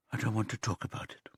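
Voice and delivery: fast, breathless whisper. A narrator's reading. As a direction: hurry the refusal out under your breath. Frightened girl refusing to answer.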